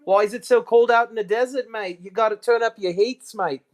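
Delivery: playful mock Aussie teasing about the cold; Aussie accent. Streamer teasing a cold Aussie viewer in mock Australian accent. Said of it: "another Aussie".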